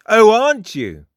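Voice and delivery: high, loud challenge; pointed, high-pitched character retort; challenging. A narrator's reading. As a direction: throw it back as a taunting question. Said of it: "challenged Frost".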